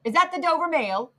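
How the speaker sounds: high, carrying call; calling from afar. A narrator's reading. Rider to the mail coach through mist, calling out. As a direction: pitch it up as a distant hail. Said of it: "a man's voice called from the mist".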